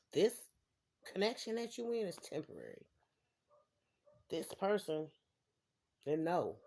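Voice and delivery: ordinary talk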